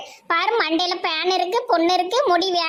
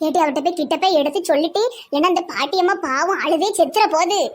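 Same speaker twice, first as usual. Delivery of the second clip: ordinary delivery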